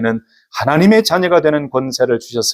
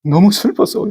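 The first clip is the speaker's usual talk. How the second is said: imitated tearful sadness; 7 semitones higher than usual. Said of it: "왜 그래요?".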